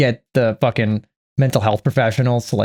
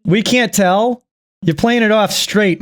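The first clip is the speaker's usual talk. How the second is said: much higher, slow, dripping sarcasm; sarcastic mockery; 8 semitones higher than usual. Real, not acted. Sarcastically mocking Jamie's claim.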